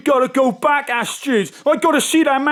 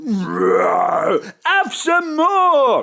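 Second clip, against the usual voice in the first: drawn-out, slow lion voice; lightly voiced lion; 5 semitones lower, much slower than usual. [name] as a giant lion urges more beer, slow.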